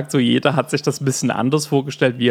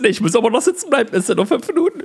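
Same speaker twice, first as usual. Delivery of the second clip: hyperventilierend, hoch und gehetzt; panicky gasping. Retold or imitated. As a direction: nach Luft ringend, panisch. Retold panic in the sauna. (panisch nach Luft schnappend)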